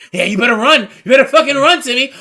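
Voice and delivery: loud, high taunting yell; mock menacing shout. Imposter chasing little Timmy, loud mock threat. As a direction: holler it after a fleeing victim.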